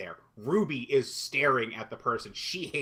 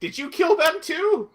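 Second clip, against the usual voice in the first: higher-pitched comic re-enactment; joking mock dialogue; 7 semitones higher than usual. Co-host joking about Raven finding dead people, mock dialogue. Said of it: "I'm just making a joke".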